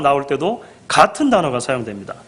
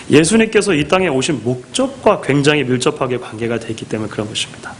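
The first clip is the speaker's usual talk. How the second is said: ordinary talk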